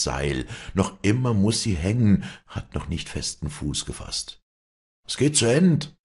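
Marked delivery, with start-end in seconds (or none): 5.1-6.0 loud, high call down the line. Acted. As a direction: shout it to the others at the rope. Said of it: "ruft der Letzte". (laut rufend)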